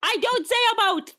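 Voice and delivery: high-pitched, mock-outraged protest; indignant outburst. Real, not acted. Accused Canadian defends herself to her friend, high indignant outburst. Offended denial of saying aboat.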